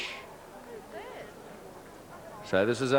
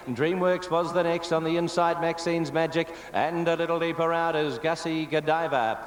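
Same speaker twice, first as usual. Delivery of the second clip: brisk running race commentary; race caller; 4 semitones higher than usual. Real, not acted. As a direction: call the field as they run. Live horse race call.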